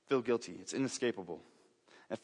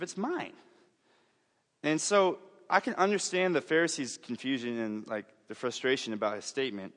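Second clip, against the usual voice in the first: usual speaking voice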